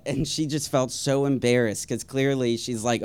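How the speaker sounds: ordinary talk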